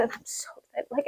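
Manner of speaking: breathy secret whisper of excitement. Real, not acted. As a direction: whisper it like a thrilled confession. Excited aside mid-gush.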